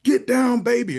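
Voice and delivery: high, loud parakeet cry; bird yelling. Retold or imitated. Parakeet to the dancing club crowd, high exuberant mock yell. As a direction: squawk it out high as the party bird. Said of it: "bird yells out".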